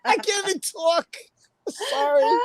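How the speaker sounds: high-pitched, tearful laugh-talk; laughing too hard to talk; crying with laughter. Real, not acted. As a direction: barely get the words out through laughter. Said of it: "I'm crying".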